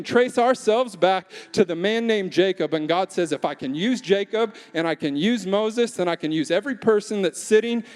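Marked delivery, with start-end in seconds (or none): none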